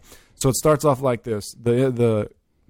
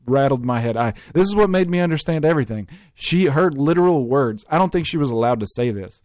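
ordinary talk

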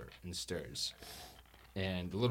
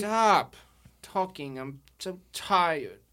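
put-on cranky sleepy girl; 6 semitones higher, 4 dB louder, slower than usual. Girl annoyed at being woken.

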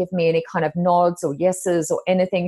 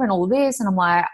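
ordinary talk

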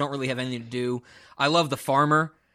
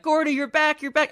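high-pitched mock child's greeting; imitated eager little kid; excited kid, imitated; 13 semitones higher, 3 dB louder than usual. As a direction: call it out high like a kid running up. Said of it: "the kid comes running out like".

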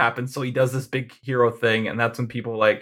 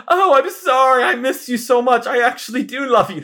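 much higher, louder gushing confession; hammed-up lovestruck gush; mock swooning lover; 11 semitones higher, 5 dB louder than usual. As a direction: overdo the sudden lovesick turn. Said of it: "she's like".

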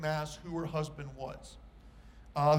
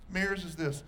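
ordinary talk

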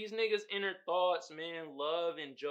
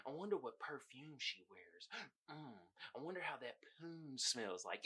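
much softer, lower, lovestruck murmuring; dreamy creepy inner voice; 3 semitones lower, 16 dB quieter than usual. Retold or imitated. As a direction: breathe it like an obsessed stalker. Imitating Joe's creepy inner monologue.

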